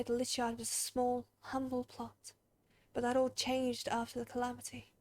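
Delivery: solemn graveyard keeper. Acted. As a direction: speak gently, as one who tends the graves. Reads solemn graveyard keeper's dialogue.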